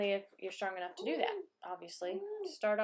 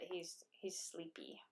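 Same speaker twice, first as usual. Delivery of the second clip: soft whisper; 3 semitones lower, 13 dB quieter, slower than usual. Real, not acted. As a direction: hush it to a whisper. Mother whispering about sleepy baby.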